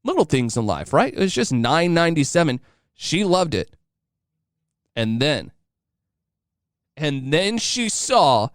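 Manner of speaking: usual speaking voice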